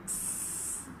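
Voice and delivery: س- as a long soft unvoiced hiss, slowed; hissed s sound. Shown as a demonstration. Teacher showing the hissing sound of seen. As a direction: let out a slow sss hiss. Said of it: "سٹ کی آواز نکلنی ضروری ہے".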